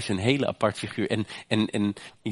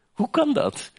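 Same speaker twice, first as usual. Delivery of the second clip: rhetorical cry. Real, not acted. Preacher asks the church "Hoe kan dat?" high and amazed. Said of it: "rhetorical cry".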